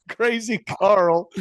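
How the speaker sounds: slow, high, squeezed out through laughter; real laugh. Real, not acted. Host reads out Crazy Carl story request, really laughing. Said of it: "that's a real laugh".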